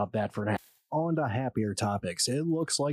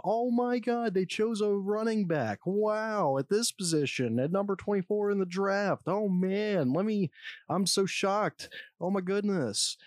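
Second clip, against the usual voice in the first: hammed-up shock, voice held high; mock shocked voice; 8 semitones higher than usual. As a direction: put on exaggerated shock, pitched well up. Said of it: "put on my shocked voice".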